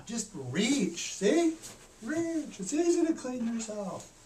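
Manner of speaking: put-on high, loud, bright kid explaining; high-pitched, loud childlike demonstration; eager child voice. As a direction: show grandma how easy it is.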